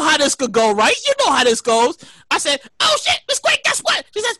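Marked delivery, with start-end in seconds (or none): 2.8-4.0 high-pitched frantic calling; mock panicked yelling. Son phones mom about the protest, high mock yelling. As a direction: blurt it high, acting out your own panic. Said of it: "Why are you yelling for?".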